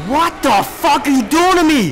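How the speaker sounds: high, loud outraged panic; alarmed shouting. Real, not acted. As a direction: shout it in shocked protest. Alarmed protest in game.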